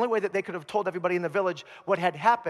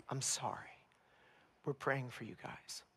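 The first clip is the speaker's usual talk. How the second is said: much softer, gentle sympathy; hushed condolence; 6 semitones lower, 15 dB quieter than usual. Acted. Neighbor consoling the mother of the possessed man, very soft. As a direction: murmur it low to a grieving mother.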